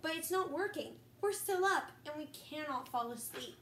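ordinary talk

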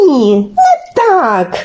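slow, whining drawn-out objection; exasperated correction. Acted. Olenka tells Telesyk he is wrong, drawn-out and exasperated. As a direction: stretch each word in frustration.

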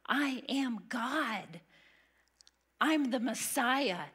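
slow, weighty proclamation; slow bold declaration. Acted. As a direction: lean on every word, declaring it. Jesus boldly declaring himself.

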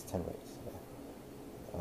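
whispered, slow afterthought; whispered aside. Real, not acted. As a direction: breathe it out to yourself. Quiet self-correction.

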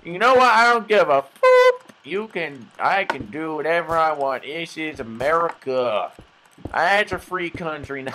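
defiant hobo, high and loud; loud mock-patriotic hobo rant; homeless guy. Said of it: "random homeless guy that I'm impersonating".

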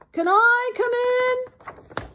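high, slow, loud yell. A narrator's reading. Rhinoceros knocks at the door, yelling. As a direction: yell it drawn out from outside. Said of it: "knocked and yelled".

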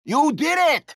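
high, shouted congratulations; excited cheer. Acted. Game host shouting congrats to the winning player, excited.

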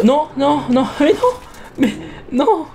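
high, rapid string of protests; alarmed protest. Real, not acted. Gamer reacting to a mishap in the game, alarmed. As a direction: cry out as things go wrong, half laughing.

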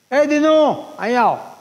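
loud high call across the house. Retold or imitated. Uncle calls Dinu from inside the house, loud high call. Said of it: "જી બાપાએ બૂમ પાડી".

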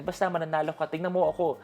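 high mock voice of an insistent old father; imitating the old man. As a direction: lightly play the old man urging trust. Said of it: "Parang sabi niya".

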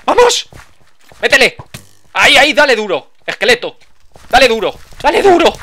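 loud, high cheering; excited shouting. Real, not acted. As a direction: yell encouragement at the fight. Fighting skeleton, cheering on.

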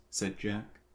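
plain reading voice

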